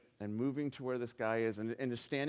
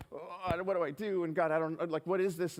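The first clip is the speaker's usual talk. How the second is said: higher-pitched, mock-panicked inner plea; retold panic; 5 semitones higher than usual. Man spotting the guy who hurt his family, panicking. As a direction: act out a flustered, panicky prayer. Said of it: "I'm panicking inside".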